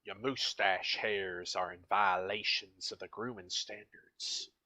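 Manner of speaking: slow, lightly voiced drill sergeant; a hint of barking first sergeant, slow; stern first sergeant. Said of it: "That's the first sergeant, correcting military deficiencies".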